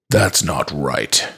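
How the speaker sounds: low, slow, ominous father; dark tone. A narrator's reading. Father doubts the talking toy, dark. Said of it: "a darkness in his tone".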